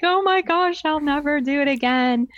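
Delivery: put-on high, panicky little girl; a daughter melting under the evil eye; mock panic. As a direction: squeal it like a scared kid.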